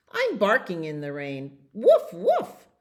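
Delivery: high, loud yappy woof; a puppy's happy woofs; barking dog. Acted. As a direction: bark it out like a little dog. Dog joins the song.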